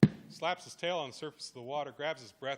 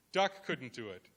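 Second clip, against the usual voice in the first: plain reading voice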